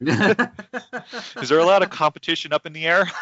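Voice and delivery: high, loud, through laughter; laughing question. Real, not acted. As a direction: crack up while asking. Joking question amid shared laughter.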